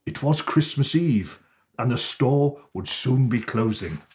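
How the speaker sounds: ordinary delivery, nothing marked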